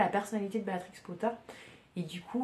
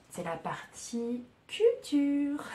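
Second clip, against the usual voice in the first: higher, louder, slow drawn-out announcement; mock announcer; 8 semitones higher, 6 dB louder, much slower than usual. Podcaster introduces her culture segment to listeners, mock announcer voice. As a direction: announce the segment like a grand TV host.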